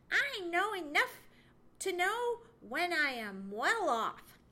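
loud, high, self-satisfied squirrel; retorting squirrel. A narrator's reading. Chatterer contented with his own home, retorting. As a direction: retort with high-pitched certainty. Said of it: "retorted Chatterer".

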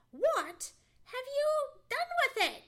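high, loud, aghast question; astonished squirrel. A narrator's reading. Squirrel questioning Johnny about his home, incredulous. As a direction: demand it in squeaky disbelief. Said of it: "Chatterer's voice sounded as if".

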